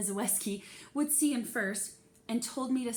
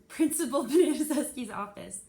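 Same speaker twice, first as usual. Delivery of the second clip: louder words broken by real laughter; laughing at a flub; 4 semitones higher, 8 dB louder than usual. Real, not acted. Reader laughs after tripping over the principal's name. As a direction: laugh through the stumbled name.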